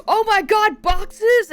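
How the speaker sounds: squeaky high overexcited cat; high, loud, thrilled little pet voice; excited cat voice. Retold or imitated. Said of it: "she was like".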